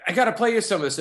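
can't wait to play it back; excited engineer. Retold or imitated. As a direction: engineer eager to share the take. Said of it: "and he goes".

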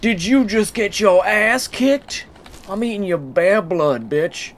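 high, drawn-out taunt; mock-sorry gloating. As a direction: gloat over a beaten enemy. Gloating at a beaten bear.

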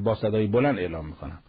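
usual speaking voice

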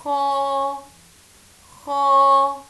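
long خا with the vowel stretched; slow teaching demo of خا; drawn-out kha. Shown as a demonstration. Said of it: "نقرأ مع بعض".

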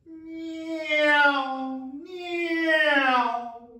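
high, loud car-passing noise, nearly shouted; car whoosh. Shown as a demonstration. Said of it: "Maybe a couple more".

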